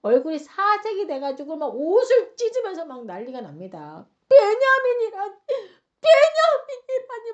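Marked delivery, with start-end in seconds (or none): 4.3-7.3 loud, high, drawn-out wail; acted anguished exclamation, slow and very high; aghast outcry. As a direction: cry out the name in horrified disbelief. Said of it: "막 난리가 나요".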